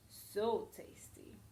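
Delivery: slow, whispered relish; whispered savouring. Acted. Wolf after eating Granny, whispered relish. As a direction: breathe it out with relish.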